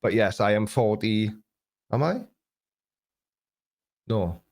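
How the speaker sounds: normal talking voice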